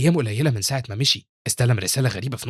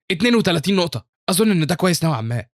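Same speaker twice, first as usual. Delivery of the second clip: high, pleased announcement; narrated cheer with raised pitch; celebrating; 6 semitones higher, 3 dB louder than usual. A narrator's reading. Big student celebrating his score, pleased and excited. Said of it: "واحد ضخم بيحتفل وبيقول".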